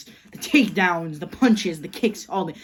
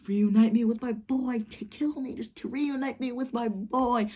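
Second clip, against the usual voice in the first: higher, strained pleading voice, lightly acted; mock crying Slade; 6 semitones higher than usual. Slade begging Bruce to kill him, mock crying. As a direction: plead to die like a broken father. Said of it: "just, like, crying".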